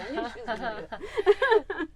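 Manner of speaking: high, loud laughing remark. Real, not acted. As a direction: say it through real laughter. Laughing at host's question.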